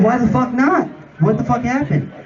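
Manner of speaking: high-pitched acted outrage; indignant demand. Jerry demands to know why the drinks didn't go out, indignant. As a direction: demand an answer, voice jumping up.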